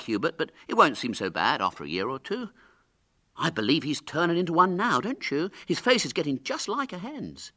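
put-on high, loud chirpy boys; schoolboys' mock comfort. A narrator's reading. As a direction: chime in with unhelpful consolation.

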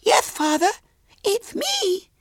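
put-on very high child voice; piping little girl. A narrator's reading. Daughter answering her amazed father, piping. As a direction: answer in a squeaky small girl's voice.

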